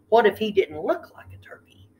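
ordinary delivery

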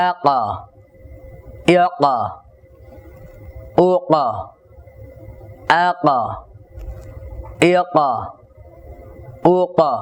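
আকা with a stopped, bouncing ক; qaf with sukun. Shown as a demonstration. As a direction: stop on the ক and let it jolt. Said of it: "যদি আমরা সাকিন উচ্চারণ করি".

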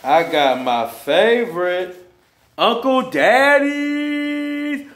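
high, slow, drawn-out excitement; excited. Real, not acted. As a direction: stretch it out, thrilled. Excited about favorite food.

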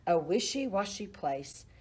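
ordinary delivery, nothing marked

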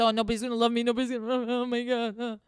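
imitating her past self crying on the bathroom floor, whiny; mock whining. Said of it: "cry about that bullshit".

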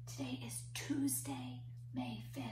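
leaning in to whisper; soft breathy counting whisper. Shown as a demonstration. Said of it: "We're gonna use our whisper voice today".

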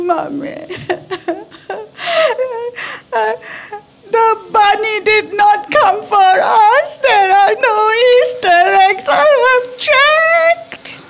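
high, slow mock sobbing little girl; crying child. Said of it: "She came crying".

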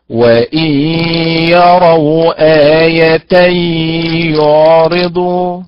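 يعرضوا stretched very slowly with misplaced stress, sounding like a dual; deliberately wrong reading. Shown as a demonstration. Teacher performs the wrong reading as a quiz for students. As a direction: exaggerate the mistake so students spot it. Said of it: "سأنطق الخطأ".

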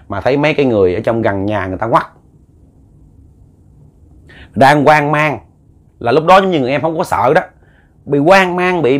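ordinary delivery, nothing marked

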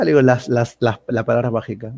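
ordinary talk

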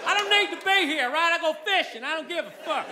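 put-on Southern-accented Einstein pleading, then giving up. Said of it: "if Albert Einstein had a really harsh Southern accent".

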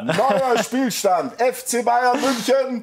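slow, high announcer score call; stadium announcer. Acted.

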